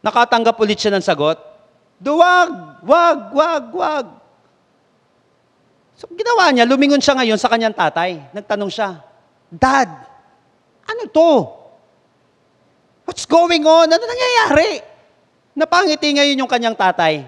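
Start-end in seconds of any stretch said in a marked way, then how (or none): 2.0-4.1 echo. Acted. Echo repeating the shout. 9.5-14.8 higher and higher, bewildered questions; confused child. Acted. Puzzled boy turning to his dad for answers.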